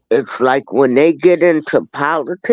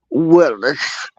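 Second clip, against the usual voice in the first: ordinary delivery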